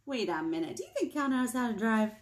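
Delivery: ordinary delivery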